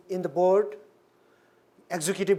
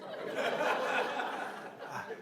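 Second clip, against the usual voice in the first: soft, airy chuckle after a surprise; amused quiet laugh; breathy laugh; 6 semitones higher, 11 dB quieter than usual. Real, not acted. Laughing at own shock.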